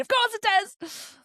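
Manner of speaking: high, drawn-out mock cheer; sarcastic exasperation. Real, not acted. Passenger hearing train loses carriages, sarcastic exasperation. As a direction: draw it out high with heavy sarcasm.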